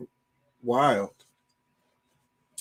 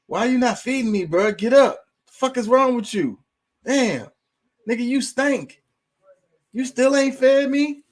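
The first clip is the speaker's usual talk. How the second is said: higher, pushy mock cat; cat's voice; 10 semitones higher, 4 dB louder than usual. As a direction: voice a bossy, hungry cat nagging its dead owner. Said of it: "they'll be like".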